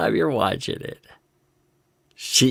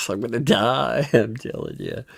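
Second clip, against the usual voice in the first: normal talking voice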